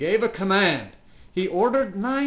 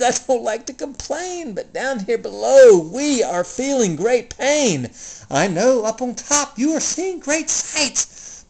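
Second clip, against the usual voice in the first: groaning pleading turtle; 7 semitones higher, 4 dB louder than usual. Acted. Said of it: "groaned Mac".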